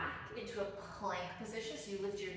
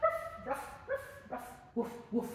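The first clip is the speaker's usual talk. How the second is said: high yappy ruff ruffs, much higher; puppy barking; 13 semitones higher than usual. Shown as a demonstration. Teacher barking like a dog in downward dog. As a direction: bark like an excited little dog. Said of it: "they go ruff".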